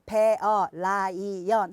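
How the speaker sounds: 베어, 라이언 in separated Korean syllables; wrong syllable split. Shown as a demonstration. Mom modelling words for her child, 베어, 라이언 split by syllable. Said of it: "다 틀린 발음이에요".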